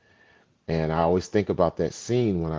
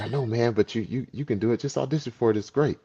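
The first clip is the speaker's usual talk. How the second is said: higher-pitched upbeat persuading; coaxing friend; 6 semitones higher, faster than usual. Retold or imitated. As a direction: imitate a buddy talking someone into it. Retold friend urging him to audition.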